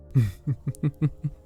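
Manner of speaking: light, high rippling chuckle; teasing giggle. Acted. Bartender teasing a flustered vampire guest, teasing giggle.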